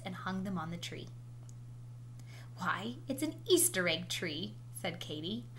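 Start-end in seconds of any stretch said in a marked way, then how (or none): none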